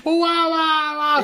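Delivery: drawn-out, slow, high whoas; mock alarm. Stopping his girlfriend at hand grenades, slow mock alarm. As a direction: stretch each whoa out in disbelief. Retells stopping her mid-list.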